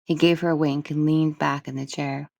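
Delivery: usual speaking voice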